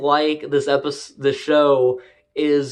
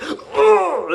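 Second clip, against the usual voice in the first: imitated angry grunt. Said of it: "Theo's like".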